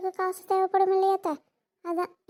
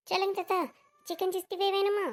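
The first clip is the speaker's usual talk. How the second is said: ordinary delivery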